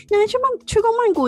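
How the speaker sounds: high, incredulous squeak; mock disbelief. Teasing a colleague who never flew Bangkok, in mock disbelief. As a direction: act shocked he never went.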